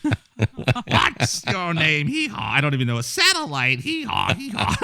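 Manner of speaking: high braying hee-haw; donkey bray. Pretend.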